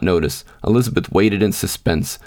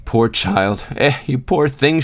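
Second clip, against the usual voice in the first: moaning; 5 semitones higher, slower than usual. A narrator's reading. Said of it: "she moaned".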